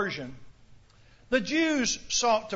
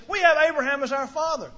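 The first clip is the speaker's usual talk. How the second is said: "We have Abraham as our father" pushed out louder and higher; emphatic claim; 4 semitones higher, 5 dB louder than usual. Retold or imitated. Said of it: "they put a lot of emphasis on that".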